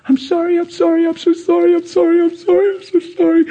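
high, pleading, tearful; imitating the wife weeping her apology; sobbing apology. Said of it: "crying her little eyes out".

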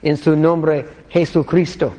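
Spanish words En su nombre, Jesucristo, said native-style; Spanish prayer. Shown as a demonstration. Showing how he'd pray in Mexico, in Spanish. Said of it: "saying something along the lines of".

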